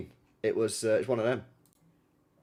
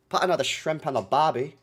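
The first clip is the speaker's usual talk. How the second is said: cheesy Aussie catchphrase through a laugh; hammed-up Australian accent; 3 semitones higher, 4 dB louder than usual. Shown as a demonstration. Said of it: "It's not good, chat".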